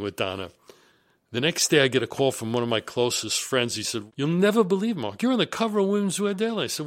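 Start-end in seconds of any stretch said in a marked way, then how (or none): 4.2-6.6 higher, quick, breathless-news delivery; excited friend. Retold or imitated. Close friend phoning with startling news, excited imitation.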